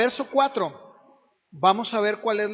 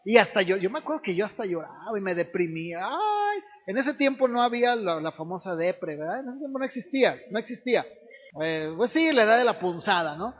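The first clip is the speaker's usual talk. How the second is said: ordinary delivery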